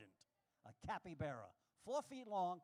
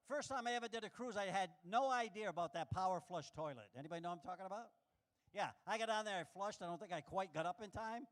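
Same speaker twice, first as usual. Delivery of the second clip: ordinary talk, nothing marked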